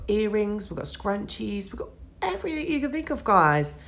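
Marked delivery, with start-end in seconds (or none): 1.7-3.7 hiccuping mid-sentence; with hiccups. Real, not acted. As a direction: talk through the hiccups. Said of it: "I've got hiccups now".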